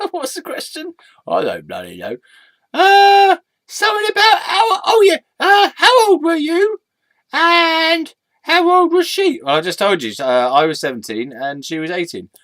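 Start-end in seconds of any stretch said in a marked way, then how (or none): 0.1-0.9 high, soft, giggly question; squeaky echo. Acted. Sidekick echoes a forgotten question, high and amused. Alter ego echoes while laughing. 2.7-9.4 very high, hesitant then drawn-out questioning; squeaky alter ego remembering. Acted. Sidekick recalls the question for him, high-pitched and halting.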